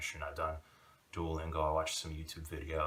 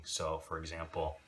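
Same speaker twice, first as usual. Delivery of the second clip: normal talking voice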